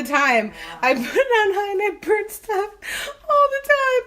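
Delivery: laughing. Real, not acted. Laughing at her own burnt cooking.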